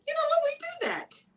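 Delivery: surprised, delighted recognition; excited realization. Retold or imitated. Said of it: "And I was like".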